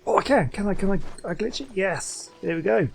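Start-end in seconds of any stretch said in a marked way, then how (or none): none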